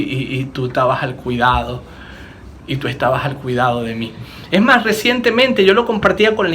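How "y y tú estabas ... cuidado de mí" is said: tender. Real, not acted. Tender realization about God's care.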